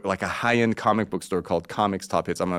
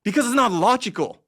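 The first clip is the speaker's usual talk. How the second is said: high exasperated answer; 12 semitones higher than usual. Retold or imitated. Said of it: "And I'm like".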